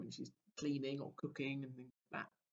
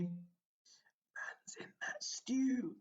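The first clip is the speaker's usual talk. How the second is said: whisper; 7 semitones higher, much slower than usual. Acted. Dramatic story moment whisper.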